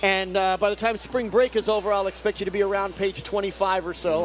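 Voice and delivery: lightly voiced stern teacher; strict teacher. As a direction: assign homework like a stern teacher. Plays strict teacher assigning homework.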